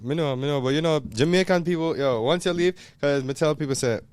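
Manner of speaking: animated Jamaican patois. Acted. Host explaining his roots to fiancee, Jamaican patois. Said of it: "You're Jamaican".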